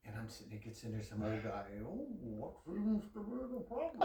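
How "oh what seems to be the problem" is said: lightly voiced doctor, pitched higher with a laugh; a hint of a chirpy doctor asking; mock doctor. Doctor asking the patient what's wrong, lightly mocked.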